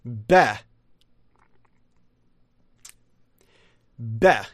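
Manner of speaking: "beh" with a short E and no R; dictionary pronunciation. Shown as a demonstration. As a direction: say "beh" alone, short open E, R dropped. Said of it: "says to pronounce it".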